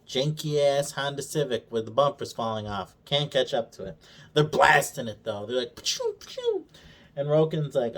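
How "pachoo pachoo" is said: high pew pew blaster noise; mouth blaster sounds. Retold or imitated. Retelling the ship chase, high mock blaster sounds. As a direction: squeak out laser shots.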